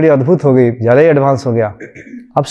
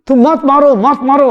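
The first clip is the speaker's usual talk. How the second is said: mock terrified cry; 10 semitones higher than usual. Wife waking to husband's hand at her throat, mock scream. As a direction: imitate a frantic "don't hurt me" cry. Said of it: "और चिल्ला के वहां से".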